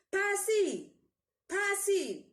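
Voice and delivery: loud high call across the house. A narrator's reading. Mother calling her son back from the door, loud. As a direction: call him back from the doorway. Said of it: "Mother calls again".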